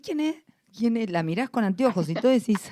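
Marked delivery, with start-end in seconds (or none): 0.0-1.1 puzzled. Retold or imitated. Puzzled driver honked at.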